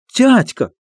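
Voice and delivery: put-on high excited child's cry; child's shout. A narrator's reading. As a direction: call out like a child. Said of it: "крикнул детский голос". (детский выкрик)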